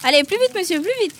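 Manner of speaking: lively hurry-up; urging on. Real, not acted. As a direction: chivvy a contestant along playfully. Host hurries contestant stuffing in sweets.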